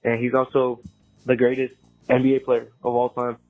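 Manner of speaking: recorded phone message; leaving a voicemail. Real, not acted. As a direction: voicemail played over the air. Caller's voicemail played on show.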